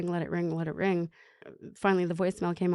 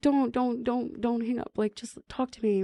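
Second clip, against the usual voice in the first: higher-pitched, imploring plea; retold begging; 5 semitones higher than usual. Mother begging her daughter not to hang up, pleading. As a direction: plead with a child to stay on the line. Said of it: "I was begging for her".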